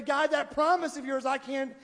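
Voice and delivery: loud protest to God. Retold or imitated. Believer refusing God's promise, loud protest. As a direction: throw the words up at God in protest. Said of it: "We try to say".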